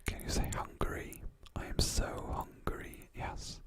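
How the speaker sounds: normal talking voice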